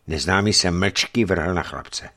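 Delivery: ordinary delivery, nothing marked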